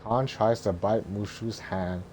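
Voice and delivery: usual speaking voice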